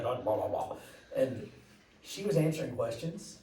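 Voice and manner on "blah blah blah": lightly mimicked foreign babble; mock gibberish. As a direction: babble like the big president. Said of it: "he talks like that".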